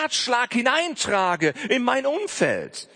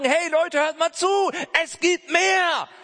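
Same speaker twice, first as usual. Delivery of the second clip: raised, higher call to everyone, slowing at the end; rally the crowd: hey, listen, there's more; 9 semitones higher than usual. Pretend. Preacher calling out to people.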